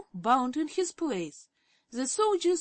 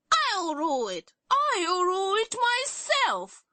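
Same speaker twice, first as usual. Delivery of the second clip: loud, climbing higher on the second cry; 10 semitones higher, 5 dB louder than usual. A narrator's reading. Princess fights her sisters for the throne, rising loud cry. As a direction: push louder and higher to claim the crown. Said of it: "cried Cerulia".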